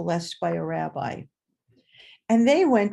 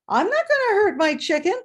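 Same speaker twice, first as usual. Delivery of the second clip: higher and louder, stubborn refusal; defiant child; 10 semitones higher, 7 dB louder than usual. Retold or imitated. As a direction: dig in your heels like a little girl.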